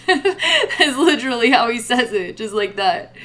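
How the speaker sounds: ordinary delivery, nothing marked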